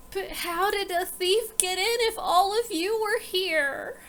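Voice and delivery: lightly voiced Fanny. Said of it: "she's like".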